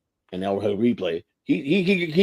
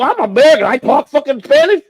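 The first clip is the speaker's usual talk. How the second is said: indignant protest; 13 semitones higher, 6 dB louder than usual. Retold or imitated. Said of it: "I go".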